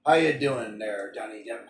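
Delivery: playful pirate accent, lightly put on. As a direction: greet him like a pirate. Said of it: "I might do a pirate accent".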